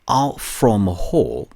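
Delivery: ordinary delivery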